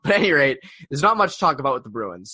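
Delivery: ordinary talk, nothing marked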